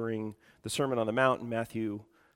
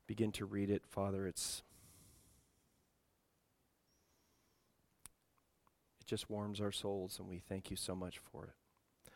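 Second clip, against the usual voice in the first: soft, subdued prayer to the Father; 5 dB quieter than usual. Real, not acted. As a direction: pray quietly and humbly, with reflective pauses. Leads the congregation in prayer.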